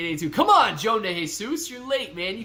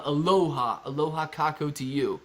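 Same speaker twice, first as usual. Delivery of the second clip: normal talking voice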